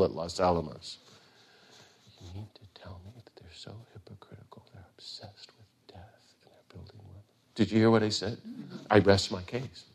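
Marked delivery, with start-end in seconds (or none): 2.2-7.5 whispered, fast gentle monk's voice; Thich Nhat Hanh impression. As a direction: breathe it out like a quiet Zen teacher. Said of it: "impression of Thich Nhat Hanh".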